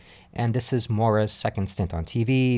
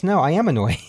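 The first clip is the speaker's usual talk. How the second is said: imitating Maura; 6 semitones higher, 5 dB louder, faster than usual. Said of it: "she kind of admits".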